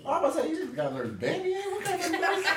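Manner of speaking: high, fast, laughing through the words; cracking up in disbelief at the name. Real, not acted. Host laughing at nurse name.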